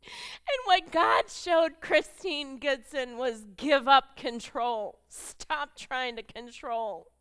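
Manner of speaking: slow, loud exhortation; fervent preaching. Acted. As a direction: urge the ladies to pray.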